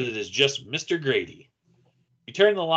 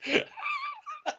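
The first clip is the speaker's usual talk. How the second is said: high laugh; 10 dB quieter than usual. Real, not acted. Narrator cracks up mid voice.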